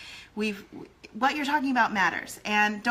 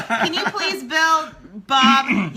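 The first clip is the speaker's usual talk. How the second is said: laughing quote; 4 semitones higher, 7 dB louder, much slower than usual. Retold or imitated. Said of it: "even with customers".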